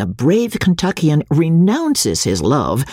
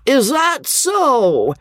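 put-on slow, much higher taunting drunk; drunken drawl; 12 semitones higher, much slower than usual. A narrator's reading. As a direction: stretch each word in a mocking drawl. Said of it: "came the maddening and disrupting refrain".

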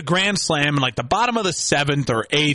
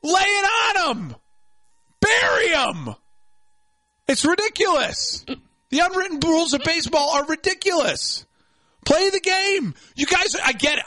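shouting rant; 11 semitones higher than usual. Real, not acted. Host ranting at baseball traditionalists, shouting higher. Ranting against unwritten rules.

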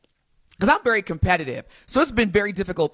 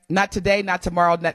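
normal talking voice

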